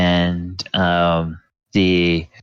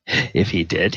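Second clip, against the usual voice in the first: usual speaking voice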